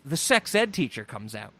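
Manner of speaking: normal talking voice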